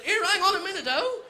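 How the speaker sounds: high, loud indignant protest; indignant yelp. Retold or imitated. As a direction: protest through a laugh. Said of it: "I went".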